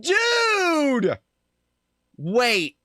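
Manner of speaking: high, loud dude of disbelief; a real stunned shout; shocked yell. Real, not acted. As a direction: call out in outrage. Reacting to the game line.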